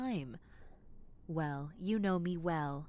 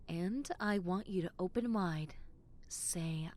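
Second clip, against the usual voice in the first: normal talking voice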